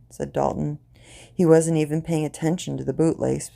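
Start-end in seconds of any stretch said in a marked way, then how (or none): none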